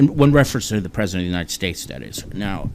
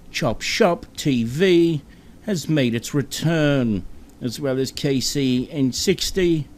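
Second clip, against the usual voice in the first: very dramatic British reporter; 5 semitones higher than usual. Acted. Host announcing the show's return as a BBC report, melodramatic British reporter. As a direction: stretch each phrase like momentous breaking news. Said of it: "Just very, very dramatic".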